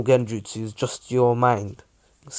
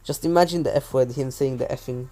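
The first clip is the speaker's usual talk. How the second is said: usual speaking voice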